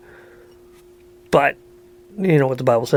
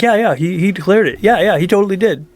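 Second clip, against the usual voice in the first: mock eager agreement; 6 semitones higher, 3 dB louder than usual. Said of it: "I know a lot of people that are like".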